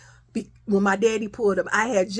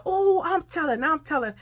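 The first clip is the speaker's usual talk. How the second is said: mock taunting threat, starting high; acted tattling taunt; 7 semitones higher than usual. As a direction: act out a sister threatening to tattle.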